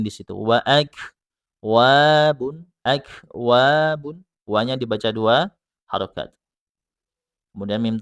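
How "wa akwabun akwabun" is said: akwabun with a clear glottal catch; slow akwabun with a breath of air at the hamza; breathy hamza. Shown as a demonstration. Teacher shows the hamza, slow, with air. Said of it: "ada angin, aliran angin".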